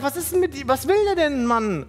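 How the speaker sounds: high-pitched exasperated muttered thought, retold; retold fed-up questioning; tilted disbelief. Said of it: "so tilt, so, ich hab gedacht".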